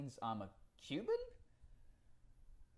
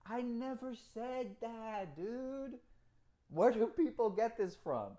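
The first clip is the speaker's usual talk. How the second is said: exasperated protest; 7 semitones higher, slower than usual. Real, not acted. Answering a commenter who misquoted him, slow exasperated protest. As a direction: drag it out in high-pitched disbelief.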